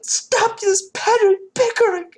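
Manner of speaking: high, loud mock snapping shout; hammed-up exasperated cry; Deanna. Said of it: "Deanna just snaps".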